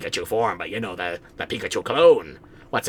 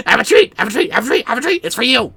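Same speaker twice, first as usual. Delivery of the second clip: much higher, louder coaxing; high doggy voice; 13 semitones higher, 7 dB louder, faster than usual. Pretend. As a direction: coo a treat at the dog. Offering treat to dog.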